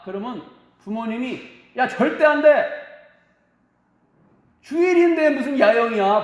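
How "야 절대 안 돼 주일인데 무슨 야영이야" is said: loud, firm mock scolding, a little higher; parents' stern refusal. Parents forbidding their son's Sunday camp, stern loud refusal. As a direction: bark a flat no like a strict parent.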